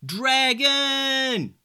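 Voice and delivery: long high desperate call; yelling out. Acted. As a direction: cry out high for help. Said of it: "he yelled out".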